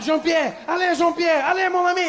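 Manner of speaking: high, hammed-up French cries with long vowels; panicked French man. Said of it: "The French guy was my favorite".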